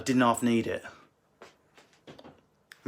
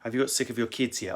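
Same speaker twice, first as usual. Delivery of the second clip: usual speaking voice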